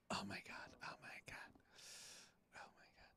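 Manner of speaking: whispered under the breath, barely there; dismayed whisper. Real, not acted. Host to himself as the stream audio fails, dismayed whisper. Muttering to self over broken audio.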